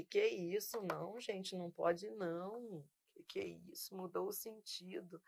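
hushed, mocking imitation of objecting students; mock protest. Teacher mocking students who object, hushed mock protest. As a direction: mimic the fussy objectors under your breath.

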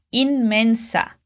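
teacher modelling both nasals in inmensa; inmensa with N before M. Shown as a demonstration. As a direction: sound the N, then the M in inmensa. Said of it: "Você vai pronunciar primeiro a letra N".

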